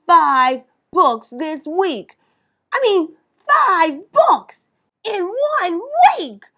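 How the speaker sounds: high, loud incredulous outburst; high loud mock-shocked cry; amazed. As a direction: disbelief at five books. Narrator boy amazed.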